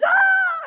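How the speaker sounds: high loud mock shriek; mock scream. Lady seeing a bloody man at her door, shrill scream. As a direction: let out a startled shriek. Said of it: "she screams".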